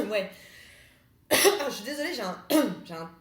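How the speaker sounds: coughing, throat catching; frog in throat. Real, not acted. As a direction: talk through a frog in throat. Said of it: "j'ai un truc dans la gorge".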